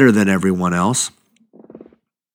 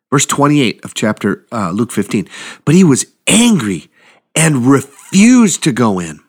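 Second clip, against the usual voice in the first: ordinary talk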